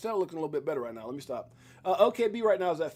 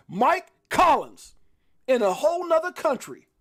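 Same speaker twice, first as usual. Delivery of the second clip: much higher, louder welcome; excited intro; 9 semitones higher, 6 dB louder than usual. Real, not acted. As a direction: hype the guest in. Announcing arriving friend.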